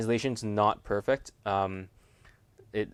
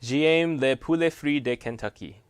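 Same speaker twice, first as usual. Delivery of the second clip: careful amateur French, slightly raised in pitch; bad French accent; 4 semitones higher, 4 dB louder than usual. Shown as a demonstration. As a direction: try French clearly despite your bad accent. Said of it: "I just have a really bad accent".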